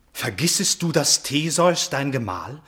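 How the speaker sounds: a son appalled for his father; horrified outburst. Acted. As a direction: cry out in horror. Stepson horrified by the confession.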